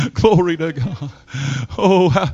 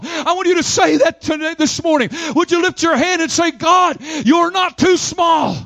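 full-force preaching; 8 semitones higher than usual. Acted. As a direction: preach it to the back row, rising to a shout. Preaching climax about God's sufficiency.

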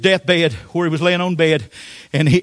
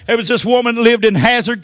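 ordinary delivery

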